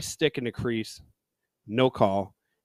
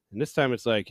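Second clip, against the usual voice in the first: usual speaking voice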